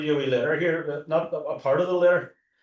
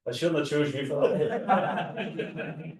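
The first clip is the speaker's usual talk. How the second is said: laughing; 3 semitones higher, much slower than usual. Real, not acted. Joking with audience after wrong answer.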